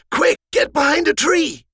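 put-on old scout ordering brothers to hide; urgent order. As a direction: hurry them into hiding. Urgent hiding command.

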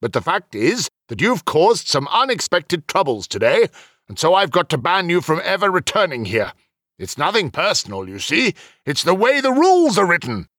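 apologetic rule-keeper; official explaining regretfully. Acted. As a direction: break the ban to him reasonably. Voices Heliok explaining the ban.

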